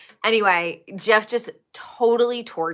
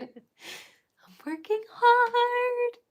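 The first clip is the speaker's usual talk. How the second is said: much higher, slowed squeaky put-on voice; goofy cartoon voice joking; squeaky mock character voice; silly high voice; 13 semitones higher, 4 dB quieter than usual. Silly voice about working hard.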